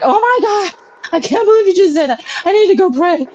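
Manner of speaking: high, fast, shocked gasp of disbelief. Retold or imitated. Retelling her shock at her atheist husband's words, high and fast. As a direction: clutch your pearls in high disbelief. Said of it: "I just be like".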